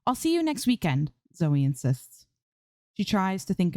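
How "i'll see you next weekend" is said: cheerful promise. Acted. Zoe promising her fans.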